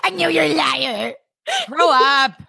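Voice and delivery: high-pitched mocking troll. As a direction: put on a silly high troll voice. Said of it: "[name]'s troll voice".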